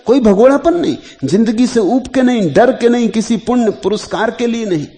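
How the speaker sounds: high-pitched, emphatic denials; forceful oratorical list; insistent preaching. Real, not acted. Discourse rising to full force.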